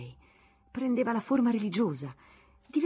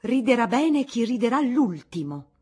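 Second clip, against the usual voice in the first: pompous, weighty; 5 semitones higher, 8 dB louder than usual. Acted. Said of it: "calcando la voce e passandogli dinanzi gonfia e impettita".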